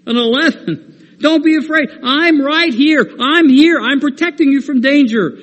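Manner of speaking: put-on the Father urgently calming a frightened child; emphatic divine promise. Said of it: "Our Father says".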